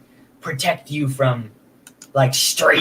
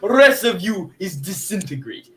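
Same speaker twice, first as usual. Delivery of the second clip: put-on Russian accent; 4 semitones higher, slower than usual. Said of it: "with a heavy Russian accent".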